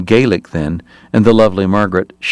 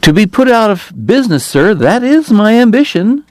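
much higher, smooth and unctuous; oily politician in a piping voice; 12 semitones higher than usual. Acted. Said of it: "Hoover continued smoothly".

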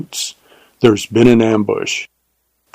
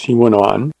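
ordinary delivery, nothing marked

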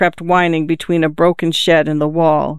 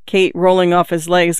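ordinary delivery

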